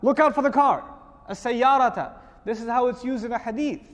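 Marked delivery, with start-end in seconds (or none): 1.3-2.1 "As-sayyarata" louder, firm falling tone; warning emphasis. Shown as a demonstration. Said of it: "You know what I'm gonna say?".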